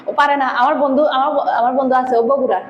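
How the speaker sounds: ordinary talk, nothing marked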